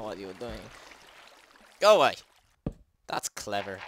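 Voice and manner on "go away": high loud shout. Real, not acted. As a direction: shout it away. Yells at creature.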